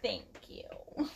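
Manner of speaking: breathy hushed low thank you; whispered thanks. Pretend. Answering her friend in a joking whisper.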